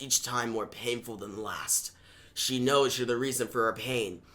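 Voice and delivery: low, soft, cold voice of a doubting mentor; deep hushed taunting mentor; Batman. Acted. Voices Batman in Tim's head.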